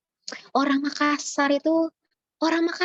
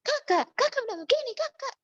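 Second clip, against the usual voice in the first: much higher, imitated blunt address; lightly voiced Makassar speaker, pitched up; mock snapping local; 13 semitones higher than usual. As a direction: sound like you're scolding without meaning to. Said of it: "udah kayak orang gertak".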